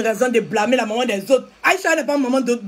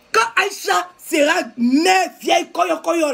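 angry shout; 4 semitones higher, slower than usual. Real, not acted. Shouting slowly at viewers cursing Aïcha, angry. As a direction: shout each word slowly at them.

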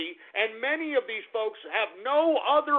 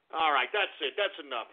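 normal talking voice

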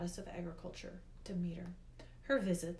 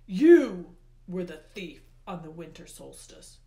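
loud, hard-hit accusation; Hades, accusing; 15 dB louder than usual. A narrator's reading. Hades names the boy as the thief, accusing. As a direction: denounce the culprit at full voice.

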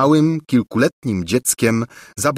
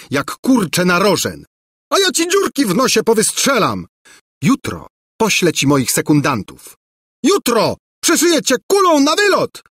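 hammed-up furious threats, much higher; mock threats; 11 semitones higher than usual. A narrator's reading. Two princes quarrelling, mock furious. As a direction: spit out boasting duel threats.

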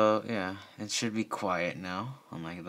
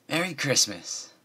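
bright, raised holiday greeting; cheerfully; 6 semitones higher, 4 dB louder than usual. Acted. Lyra cheerfully wishing the baker Merry Christmas. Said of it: "Lyra said cheerfully".

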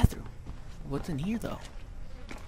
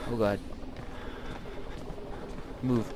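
ordinary delivery

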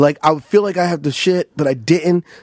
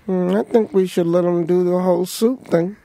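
a hint of a higher, plotting hum; lightly voiced scheming Keenan; 5 semitones higher than usual. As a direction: float the plan slyly, barely shifting the voice. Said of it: "Keenan goes".

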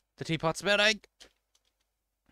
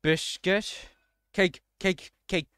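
ordinary delivery

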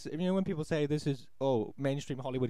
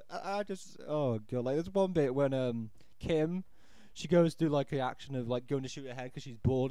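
ordinary talk

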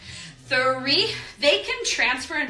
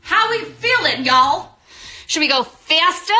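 hyping the class; 3 semitones higher, 7 dB louder than usual. Real, not acted. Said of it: "instructor hyping the class".

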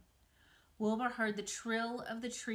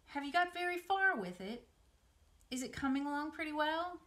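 eager worry; 8 semitones higher, 4 dB louder than usual. Acted. Anxious pig pressing spider.

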